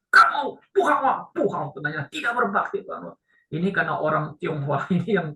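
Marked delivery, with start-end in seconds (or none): 0.1-1.7 loud, high, drawn-out mock berating; angry scolding. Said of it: "dia maki-maki anak ini". 2.1-2.9 raised-pitch mock berating; angry scolding. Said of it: "dia maki-maki anak ini".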